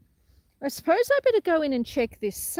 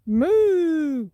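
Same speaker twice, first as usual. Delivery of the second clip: a hammed-up French-accented moo, louder; a cow mooing in a mock French accent; 4 semitones higher, 7 dB louder than usual. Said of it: "but with an accent".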